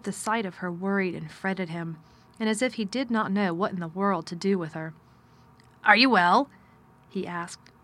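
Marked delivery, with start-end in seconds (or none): 5.8-6.5 louder curt master asking; gruff man voice. Acted. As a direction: ask it with cool distance. Mr Craven speaks.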